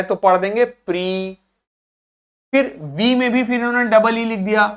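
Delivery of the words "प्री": "प्री" drawn out with a long ee; stressed syllable. Shown as a demonstration. Teacher building previous by syllables, stressed long "प्री". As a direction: lean slowly on "प्री". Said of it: "इस पे जोर देना है".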